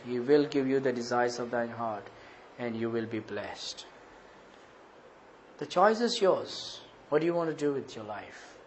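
hushed, low and grave warning; solemn preaching. Acted. Preacher warning of God's fearful judgment, hushed and solemn. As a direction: drop low and soft, let it sink in.

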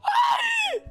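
shocked why. Acted. Friend reacts to news of sacking.

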